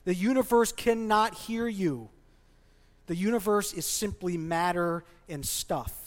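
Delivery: ordinary delivery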